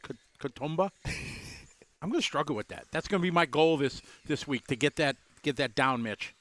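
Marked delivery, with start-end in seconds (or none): 0.0-0.9 halting, unsure "Katoomba", trailing off quietly; unsure pronunciation. Real, not acted. Host unsure how to say the town name Katoomba. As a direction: stumble on the K of "Katoomba" then ask it. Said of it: "how do we say that?".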